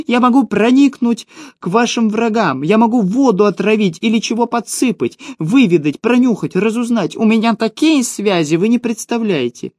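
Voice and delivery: high-pitched gnome selling his services; pleading gnome cry. A narrator's reading. Gnome offers spying services to captors, high and pleading. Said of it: "закричал гном".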